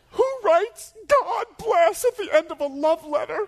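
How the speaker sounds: high, loud mock wail; hammed-up sobbing shout; crying. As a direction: imitate a hysterical, tearful rant. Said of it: "She's crying".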